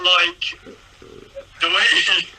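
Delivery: normal talking voice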